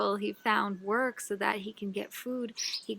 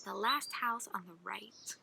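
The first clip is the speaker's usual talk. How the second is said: hushed; 2 semitones lower, 8 dB quieter than usual. Acted. Giving directions, trailing into hush.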